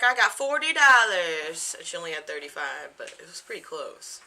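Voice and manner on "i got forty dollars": daughter bragging. Retold or imitated. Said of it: "she's like".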